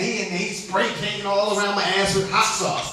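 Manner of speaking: ordinary delivery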